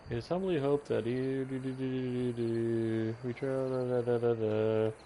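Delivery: skim the text in nonsense syllables; read-aloud that trails into da-da-da; skimming aloud. Real, not acted. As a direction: drone through the boring bits as filler.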